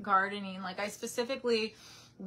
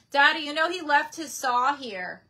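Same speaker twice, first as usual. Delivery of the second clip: louder call to her dad in the next room; 5 semitones higher, 8 dB louder than usual. Real, not acted. Calling to father across house.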